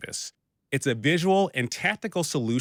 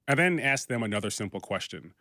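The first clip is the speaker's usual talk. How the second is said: ordinary talk, nothing marked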